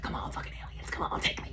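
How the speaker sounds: urgent mock plea in a whisper; hushed, whispered appeal to the skies. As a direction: beg under your breath, no voice. Acts out own plea to aliens.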